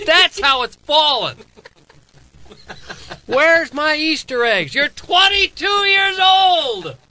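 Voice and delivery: high, loud, drawn-out outburst; exasperated yelling. Real, not acted. Father ranting about his twenty-two-year-old's Easter egg hunt, exasperated yelling. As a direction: shout it slowly in exasperated disbelief.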